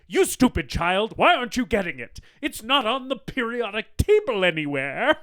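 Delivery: high, loud, impatient scolding; frustrated wicked stepmother. Retold or imitated. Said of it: "that's how she delivers all of her dialogue".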